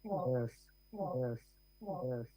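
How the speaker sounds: repeated words dying away on the line; echoing. Real, not acted. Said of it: "There's a lot of echoing going on".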